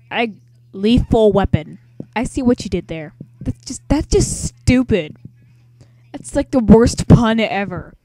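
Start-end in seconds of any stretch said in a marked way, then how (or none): 3.4-7.9 loud mock disgust. Real, not acted. Mocking a bad game pun.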